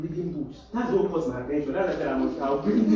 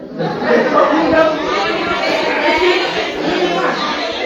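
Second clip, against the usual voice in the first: long high laughter; loud laugh; 10 semitones higher, 7 dB louder than usual. Real, not acted. Teacher laughing hard at the no-reading excuse. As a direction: crack up loudly.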